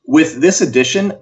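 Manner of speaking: ordinary talk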